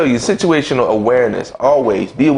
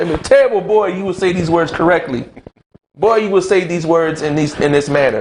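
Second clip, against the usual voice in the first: mother voiced higher, scolding her boy; mother's scolding voice; 5 semitones higher than usual. Mother scolds her son to speak correctly, higher mock voice. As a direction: play Mom correcting her son's speech. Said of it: "See, my mother, ... taught me right".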